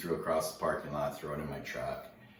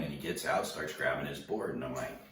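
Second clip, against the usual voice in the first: plain reading voice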